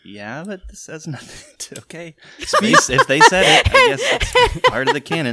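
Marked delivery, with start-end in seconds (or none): 2.4-5.3 high laughing shout. Real, not acted. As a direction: blurt it out through laughter. Laughing concession.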